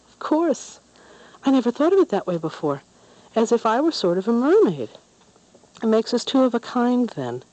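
put-on a girl brightening mid-tears; delighted through tears. A narrator's reading. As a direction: let relief burst out after crying. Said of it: "burst into laughter through her tears".

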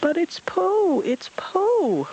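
very high, slow excited yelp; high thrilled yelp. Acted. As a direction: yelp with delight. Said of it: "Roo yelped excitedly".

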